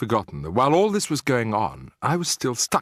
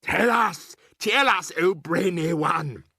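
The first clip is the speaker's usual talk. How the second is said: shouting; 10 semitones higher than usual. Acted. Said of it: "shouted the Grand High Witch".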